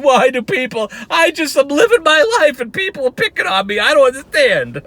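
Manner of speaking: mocking a grown man crying in the car; mock crying whine. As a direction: whimper it like a crybaby in his car.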